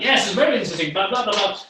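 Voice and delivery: loud, excited babble, swinging in pitch; voice going up and down. Shown as a demonstration. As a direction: mimic an over-excited talker all over the place. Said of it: "going up and down".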